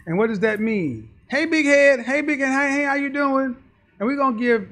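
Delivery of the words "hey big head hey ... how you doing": higher, louder sing-song hello; butter him up with a sweet hello; mock flirty greeting. Imitating a woman sweet-talking Keith, higher and sing-song.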